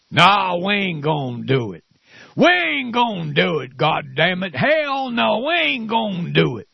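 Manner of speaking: mocking a stubborn Southern senator's refusal. Radio host mocking Southern senators, hammed-up Southern twang. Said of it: "That's what they talk like".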